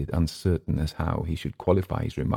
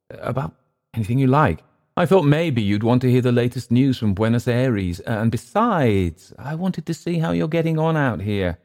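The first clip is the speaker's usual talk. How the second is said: higher-pitched, conversational captain's voice; placating visitor; 7 semitones higher than usual. Acted. Captain explaining his visit to Clift, placating. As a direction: offer friendly reasons for calling, pitched up.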